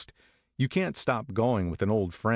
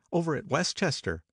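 higher, lilting Daisy voice; Daisy explaining Jordan's tournament, higher; 9 semitones higher than usual. A narrator's reading. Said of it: "explained Daisy".

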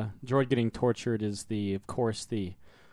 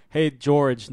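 usual speaking voice

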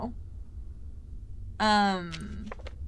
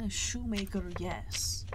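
whisper; 7 dB quieter than usual. Real, not acted. Whispered aside.

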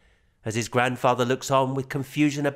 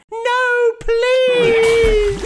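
put-on high, much louder child's plea; frantic child plea; 10 dB louder than usual. A narrator's reading. Boy begging to be believed, high loud plea. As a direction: beg like a scared little boy.

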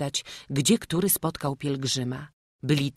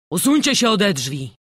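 raised, commanding call; loud order; 5 semitones higher, 8 dB louder than usual. A narrator's reading. Priest orders crowd away from church door, loud command. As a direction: call out sharply to clear them away.

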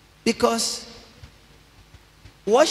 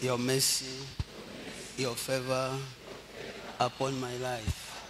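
quiet prayer; 4 semitones lower, 10 dB quieter than usual. Acted. Pastor leading the congregation in a quiet prayer. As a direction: lead them gently in prayer.

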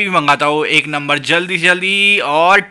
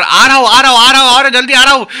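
raised, high call, repeated fast; hurry, shout you're on your way; 6 semitones higher, 4 dB louder, much faster than usual. Real, not acted. Shopkeeper tells the waiting customer he's coming, loud and high.

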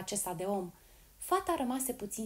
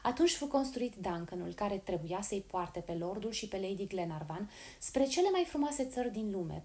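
usual speaking voice

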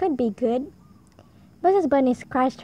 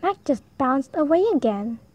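ordinary delivery

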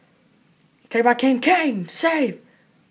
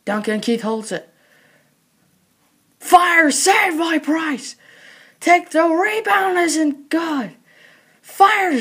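rapid live hockey play-by-play; frantic mock sports commentary. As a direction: call the game as it happens.

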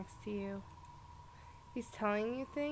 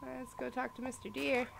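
ordinary delivery

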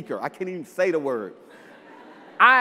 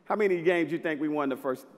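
normal talking voice

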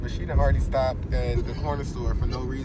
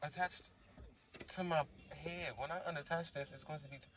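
quiet; 9 dB quieter than usual. Real, not acted. Quiet in a store.

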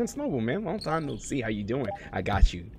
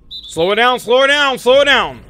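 urgent shout; 13 semitones higher, 10 dB louder than usual. Real, not acted. Yelling instructions mid game.

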